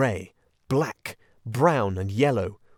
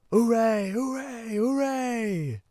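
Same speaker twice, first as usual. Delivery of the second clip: high, excited chorus of hoorays; distant cheering; 13 semitones higher, much slower than usual. A narrator's reading. Weasels cheering above the hidden friends, high and excited. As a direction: cheer like a rowdy crowd heard through the floor.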